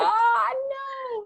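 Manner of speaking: very high drawn-out cry; mock dismay. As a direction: wail it in mock horror. Co-host's mock wail at the imitation.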